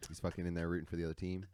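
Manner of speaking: normal talking voice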